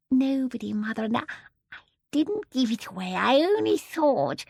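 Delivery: high, hesitant little voice; frightened daughter. Acted. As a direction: stammer out a timid excuse. Frightened daughter answers fierce mother.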